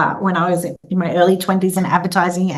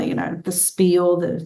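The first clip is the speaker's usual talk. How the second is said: usual speaking voice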